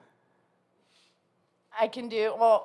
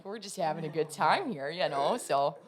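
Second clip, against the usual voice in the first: put-on Minnesota accent; 8 dB quieter than usual.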